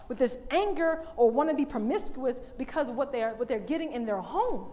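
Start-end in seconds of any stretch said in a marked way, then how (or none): none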